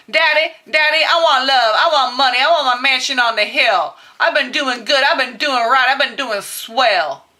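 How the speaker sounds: put-on pleading child. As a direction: plead like a kid listing wishes and good deeds. Acts pleading to Daddy Gabriel.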